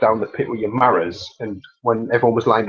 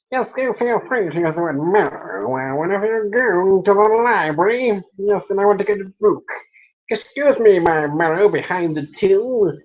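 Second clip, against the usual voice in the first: put-on high-pitched comic character with an unplaceable accent; silly voice; 8 semitones higher than usual. Customer asking at the library till for a book, in a silly high voice. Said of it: "a silly voice".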